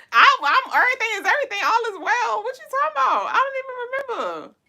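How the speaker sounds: high puzzled reassurance; bewildered. Real, not acted. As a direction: protest innocently.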